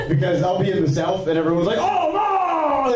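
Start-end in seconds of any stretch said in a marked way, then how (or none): 1.8-3.0 high shocked gasp; shocked Southerner. Retold or imitated. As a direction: react like a scandalized local. Southern audience reacting.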